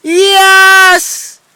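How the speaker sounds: high, loud victory shout; loud excited "Yes!"; triumphant cheer. Real, not acted. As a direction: cheer like you just won. Cheering over the defeat.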